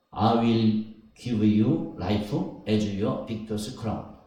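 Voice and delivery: English read very slowly, word by word. Real, not acted. Preacher reading NIV verse to the church, very slowly. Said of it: "여기 보면은".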